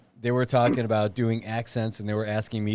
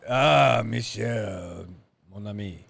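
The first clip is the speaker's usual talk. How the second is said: put-on French accent; 2 semitones lower, slower than usual. Greeting a French friend, comic French accent. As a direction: answer in a hammed-up French accent. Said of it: "can you do a French one?".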